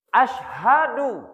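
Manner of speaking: "Asyhadu" slow, with the h clearly breathed. Shown as a demonstration. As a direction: stress the h in "Asyhadu". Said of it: "Bukan asyadu". ("Asyhadu" pelan dengan h jelas)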